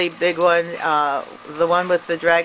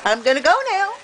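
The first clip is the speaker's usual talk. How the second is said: much higher, playful goodbye to the bird; goodbye pet talk; 10 semitones higher than usual. Real, not acted. Saying goodbye to the birds.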